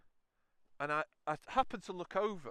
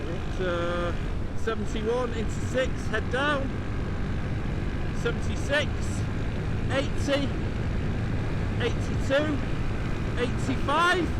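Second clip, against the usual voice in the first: loud shout climbing higher with each number; shouted speed callouts; 9 semitones higher, 12 dB louder than usual. Real, not acted. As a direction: yell it over a roaring engine, building. Calling out speed at full throttle.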